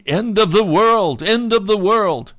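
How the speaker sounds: loud, high-pitched crowd cry; panicked yelling. Retold or imitated. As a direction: yell it like someone running through the streets. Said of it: "People were running in the streets yelling".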